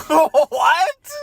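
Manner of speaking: high, loud laughing what; laughing disbelief. Real, not acted. Host reacting to absurd plot line, laughing high. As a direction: burst out in laughing confusion. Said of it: "laughing disbelief".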